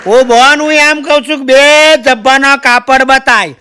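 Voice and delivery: put-on loud exasperated old uncle. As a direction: press the shopgirl again, fed up. Uncle repeats request, exasperated.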